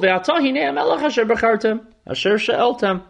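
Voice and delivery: a touch of resigned disappointment; disappointed old prophet. Acted. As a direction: sound like a tired elder let down by his people. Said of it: "you can hear the disappointment in the voice of this old ancient man".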